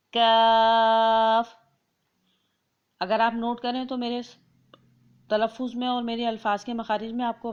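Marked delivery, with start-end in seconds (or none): 0.1-1.5 کاف held long with a light k and open "ae" vowel; thin kaaf. Shown as a demonstration. Teacher demonstrating the other letter, thin long کاف. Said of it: "اس کاف کو باریک پڑھا جائے گا".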